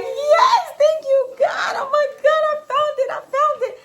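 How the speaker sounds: very high squealed cry of relief; overjoyed. Retold or imitated. Learner driver finding her lost glasses, overjoyed. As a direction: squeal your thanks, almost sobbing with joy. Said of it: "I was just so happy".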